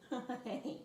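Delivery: ordinary delivery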